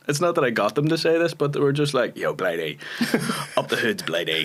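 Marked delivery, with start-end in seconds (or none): 2.2-4.4 low London yardie greeting; imitating the lads, pitched down; yardie boys. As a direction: put on a lower yardie-boy voice. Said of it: "real, like, yardie boys as well".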